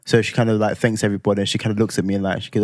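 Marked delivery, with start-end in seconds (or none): none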